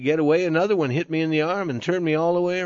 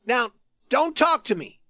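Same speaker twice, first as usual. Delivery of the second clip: high, pleading warning; despairing threat; 9 semitones higher than usual. A narrator's reading. Youth warns the tattered man again, despairing threat. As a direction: threaten him, near despair. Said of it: "with a despairing threat".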